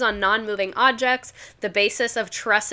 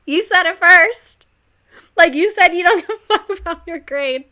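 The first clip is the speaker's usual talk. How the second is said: teasing teacher retort; 8 semitones higher, 7 dB louder than usual. Retold or imitated. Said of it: "and I'd be like".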